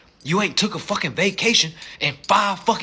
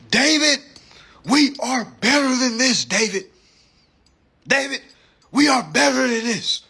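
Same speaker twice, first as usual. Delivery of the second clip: a raised, pleading inner voice calling David; 5 semitones higher, 3 dB louder than usual. Pretend. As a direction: coax yourself like a conscience begging you to do better. Inner voice urging him on.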